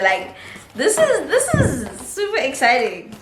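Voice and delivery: ordinary delivery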